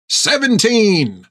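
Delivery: high, proud, drawn-out hermit cry; cackling old hermit. Acted. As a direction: crow it back, cracked and gleeful. Hank answers proudly.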